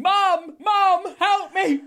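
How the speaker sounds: high, loud cries for Mum; screaming for help. Acted. Ogre collapsed on the ground, screaming for his mum. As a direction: yell for help in mock terror.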